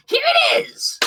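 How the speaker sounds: very high, shouted announcement; excited call. Acted. Bug announcing the ship to the party, high excited shout. Game master voices Bug calling out.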